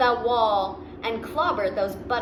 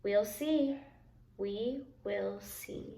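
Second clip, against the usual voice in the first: softer and slower to a hush; fading, calm; 8 dB quieter, slower than usual. A narrator's reading. Grandpa to the boy, calm and fading softer. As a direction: let it trail off, calm. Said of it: "said Grandpa".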